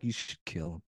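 low, soft, creepy suggestion; sinister low whisper. Retold or imitated. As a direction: breathe it darkly. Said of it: "she looks at Lex like".

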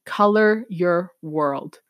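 put-on slow, word by word, like a child sounding it out; slow reading aloud. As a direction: read "Color your world" slowly, each word separate. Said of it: "I said it like that because I was reading it".

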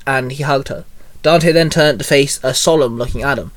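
plain reading voice

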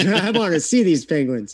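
laughing through the words; giggling as he repeats it. Real, not acted.

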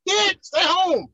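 high, laughing mock dismissal, slowing at the end; mocking brush-off. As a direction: wave them off with a laugh, a hammed-up shoo. Mock telling others to stay home.

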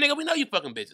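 ordinary talk

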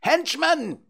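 extremely high bird screech; piercing, high-pitched caw of accusation; screeching crows. A narrator's reading. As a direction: screech it like a crow. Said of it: "the screeching of the crows".

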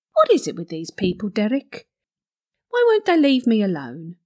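ordinary talk, nothing marked

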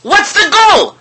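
mock outrage. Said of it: "You know what a goal is?".

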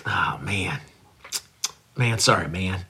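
near-whispered low sorry, eyes scrunched; remorseful whisper. Shown as a demonstration. Host giving his apology another try, whispered low. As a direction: breathe the apology out, very soft and low. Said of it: "All right, here we go".

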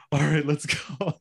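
mock resolve, with laughter right behind it; pumping herself up. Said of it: "kinda pump herself up".

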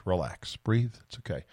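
calming himself down. Real, not acted. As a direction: talk yourself down under your breath. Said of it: "why am I having brain lock right now".

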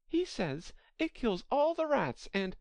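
high-pitched, eager Alice chatter. A narrator's reading. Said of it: "so Alice went on eagerly".

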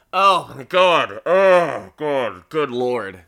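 loud, slow "oh God" of dismay; appalled and drawn out; exasperated groan. Real, not acted. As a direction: groan it out in disbelief. Exasperated at gun misuse.

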